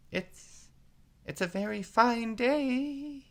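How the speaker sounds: a nervous rabbit venturing a word; timid voice. A narrator's reading. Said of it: "said a timid voice".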